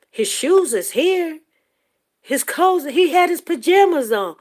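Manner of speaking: loud, high, frantic answer; re-enacted panic. Frantic mother telling police what missing son wore, re-enacted panic. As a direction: relive a frantic plea, pitch and volume way up.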